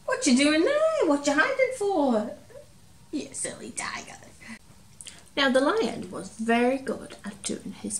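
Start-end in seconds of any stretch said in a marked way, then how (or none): 0.0-2.3 high, loud mock-scolding surprise; playful surprise. Teacher finding toy tiger hiding, high playful surprise. 3.1-4.2 fond whisper. Acted. Teasing toy tiger.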